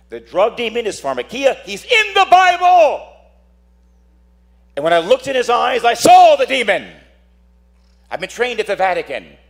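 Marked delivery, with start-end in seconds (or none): 1.6-6.9 preaching shout. Real, not acted. Preacher to the congregation, high ringing preaching shout. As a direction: ring it out to the whole hall.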